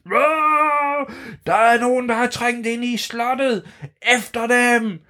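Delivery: high shrieking vampire horde yelling. Acted. Vampires yelling at the intruders, high shrieking. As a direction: screech it out like angry vampires. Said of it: "og råbte".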